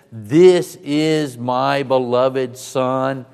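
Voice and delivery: loud, very slow proclamation; voice of God. Acted. God speaks out of heaven about his Son, loud slow proclamation.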